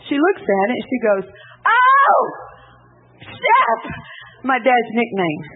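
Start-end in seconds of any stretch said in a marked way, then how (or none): 1.7-3.8 much higher, louder joyful call; mother calls her husband over the report card, overjoyed; overjoyed cry. Retold or imitated. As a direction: shout for her husband in delight.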